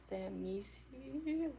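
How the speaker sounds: slow, questioning attempt at "Samisi"; unsure name pronunciation. Shown as a demonstration. Streamer sounding out a viewer's name, Samisi slow and unsure. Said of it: "I'm probably not saying that right".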